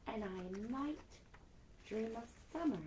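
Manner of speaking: gentle storybook reading with soft whispered lines; reading to children. Acted. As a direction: read it like a bedtime story to kids. Reading a picture book aloud.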